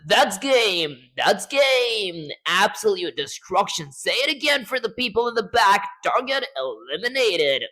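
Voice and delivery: loud, high hype call; hype announcer. Acted. Hyped announcer lines.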